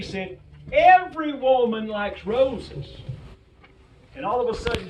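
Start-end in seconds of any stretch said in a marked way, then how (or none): 0.7-3.0 loud, slow, overconfident claim; confident blunder. Retold or imitated. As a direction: proclaim it like you can't be wrong. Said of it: "dummy me".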